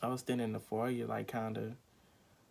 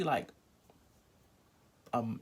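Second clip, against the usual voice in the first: ordinary talk, nothing marked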